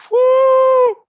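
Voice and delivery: high, loud whoop; sudden joyful yell; excited whoop. Real, not acted. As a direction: let out a big hype cheer. Hyped cheer about sandwich shop.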